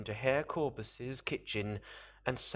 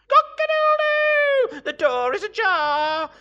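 very high, piercingly loud rooster crow; crowing cockerel; 17 dB louder than usual. A narrator's reading. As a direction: crow the news at full volume. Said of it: "said the cockerel".

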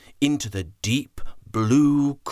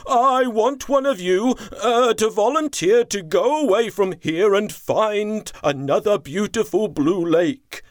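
higher, louder leader voice; chief elephant; 8 semitones higher, 4 dB louder than usual. Acted. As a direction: ask the herd for a volunteer. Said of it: "said the chief elephant".

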